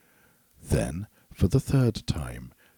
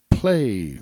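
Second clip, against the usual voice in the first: a louder, higher one-word roar; 4 semitones higher, 5 dB louder than usual. A narrator's reading. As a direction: roar the command at the harp. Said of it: "roared the ogre".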